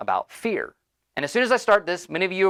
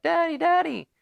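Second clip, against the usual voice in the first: mock kid voice; 12 semitones higher than usual. Kids waking faking dad.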